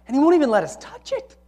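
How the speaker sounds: high-pitched protest landing on "touch it"; emphatic. Acted. Woman complaining of God's prohibition, emphatic through "touch it". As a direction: push "won't even let us touch it" up high. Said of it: "It's emphatic".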